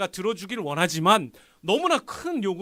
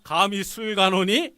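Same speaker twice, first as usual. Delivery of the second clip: louder, mock-indignant disbelief; king's indignant thought; 7 dB louder than usual. King privately reacting to cupbearer's request, indignant. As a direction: play a king affronted by an underling. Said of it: "속으로 그랬을 거야".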